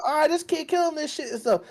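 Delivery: hyped-up reaction. Retold or imitated. Player reacting to the little kid in the game, hyped-up and high. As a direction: blurt it high and loud in amazement. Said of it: "I'm like".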